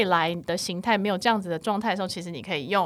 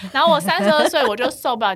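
usual speaking voice

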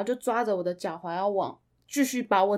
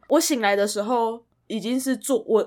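ordinary delivery

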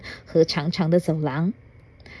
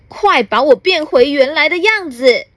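higher and a bit louder, acted angry demand; a shrunken grump yelling for his size back; angry shout; 7 semitones higher, 4 dB louder than usual. Shrunken gentleman yells at the fairy, acted angry. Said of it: "他生气地叫".

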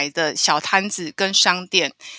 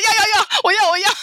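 much higher, laughing eager yes yes; 10 semitones higher, faster than usual. Retold or imitated. Retelling grabbing the only pads on offer, laughing eagerly. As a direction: gasp out the yeses through laughter. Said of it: "我就说".

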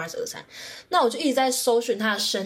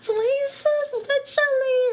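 much higher, shrieked name and questions; excited scream; slower than usual. Retold or imitated. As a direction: scream like a fan who can't believe it. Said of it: "我就尖叫啊". (尖叫着喊偶像)

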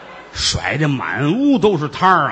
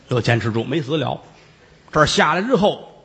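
normal talking voice

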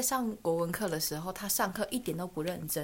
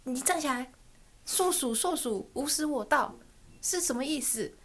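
higher, slow teacher questioning; stern teacher; 5 semitones higher than usual. Retold or imitated. Teacher catching a student reading comics, slow stern quiz. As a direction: call the pupil up and quiz him slowly. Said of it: "就叫他站起来说".

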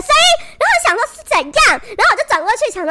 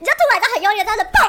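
ordinary delivery

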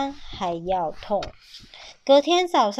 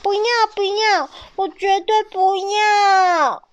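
high, wailing no; baby dinosaur wails against parting; crying refusal; 9 semitones higher, 5 dB louder than usual. A narrator's reading. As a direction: sob out the refusal.

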